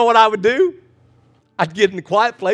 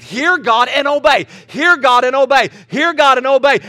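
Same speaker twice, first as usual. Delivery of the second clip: preaching refrain; 5 semitones higher, 4 dB louder than usual. Acted. Pastor repeating the command to the congregation, preaching. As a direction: drive the refrain home.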